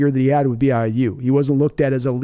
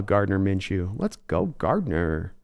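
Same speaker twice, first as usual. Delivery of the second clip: much lower, softer heroic intoning; hero theme style; 7 semitones lower, 7 dB quieter, slower than usual. Acted. Streamer hailing Gardner Minshew in a low heroic style. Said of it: "Greatest American hero style".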